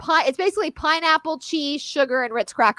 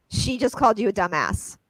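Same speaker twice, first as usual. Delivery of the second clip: ordinary talk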